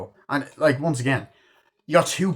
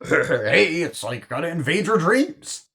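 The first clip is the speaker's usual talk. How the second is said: chuckling, higher and louder put-on voice; hammed-up comic impression; joking celebrity imitation with a laugh; Seth Rogen; 7 semitones higher, 6 dB louder, slower than usual. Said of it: "Seth Rogen".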